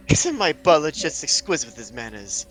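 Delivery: put-on loud, affected posh accent. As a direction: show off in a posh society accent. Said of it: "[name] has a new accent".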